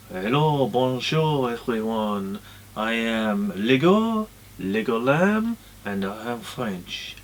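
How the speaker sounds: slow, drawn-out bad French accent; rubbish French accent. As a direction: sheep puppet in a hammy fake French accent. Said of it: "rubbish French accent".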